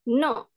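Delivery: "No" starting high, then falling; fourth-tone demo. Shown as a demonstration. Teacher summing up the tones, falling "No". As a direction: drop firmly through "No". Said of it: "nada keempat itu sama dengan bahasa Inggris".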